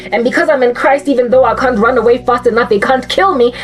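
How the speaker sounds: ordinary talk, nothing marked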